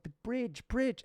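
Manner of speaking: high-pitched warning call; imitated urgent warning. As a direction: call out a danger to a passing driver. Driver warning of low bridge.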